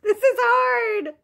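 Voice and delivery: laughing whine. Real, not acted. Struggling with the backwards game.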